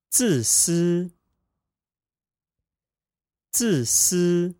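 自私 said zì sī, falling then high level; 自私 with fourth tone then first tone; practicing tones. Shown as a demonstration. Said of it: "fourth tone, first tone pair".

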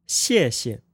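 谢谢 with a falling first syllable and a light, toneless second; Mandarin tones. Shown as a demonstration. Teacher models 谢谢 as thank you for learners. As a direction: drop the first 谢 hard, barely touch the second. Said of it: "One more time".

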